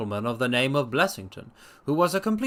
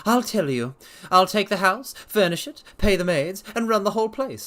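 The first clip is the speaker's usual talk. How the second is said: much higher, louder probing; Blessington voiced; 6 semitones higher, 3 dB louder than usual. A narrator's reading. Blessington probing the doctor's habits, high sharp voice. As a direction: poke at him, high and sharp.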